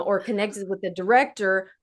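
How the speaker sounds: usual speaking voice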